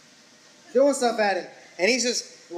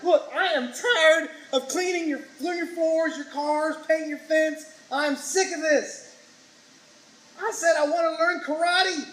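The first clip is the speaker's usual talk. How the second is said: high-pitched, fed-up student outburst; mock furious; 7 semitones higher than usual. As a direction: act out an exasperated pupil's rant. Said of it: "Daniel's furious".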